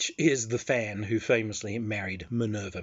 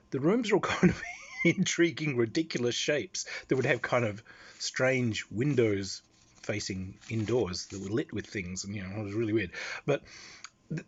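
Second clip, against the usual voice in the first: normal talking voice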